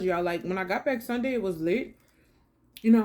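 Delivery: normal talking voice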